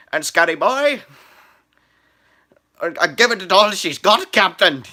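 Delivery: loud, high Scottish brogue; hammed-up Star Trek engineer; Scotty impression. Vlogger imitating Scotty from Star Trek, loud Scottish accent. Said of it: "going back to Star Trek".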